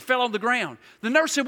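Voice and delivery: normal talking voice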